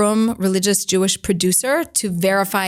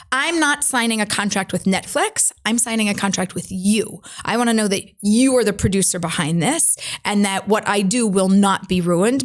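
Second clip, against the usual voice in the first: usual speaking voice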